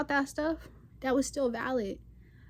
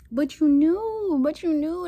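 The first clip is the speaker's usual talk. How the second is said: drawn-out, high and loud accusation; knowing accusation; 5 semitones higher, 7 dB louder, slower than usual. Real, not acted. Calling out haters who pretended not to see, drawn-out accusation. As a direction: call them out, stretching the words high.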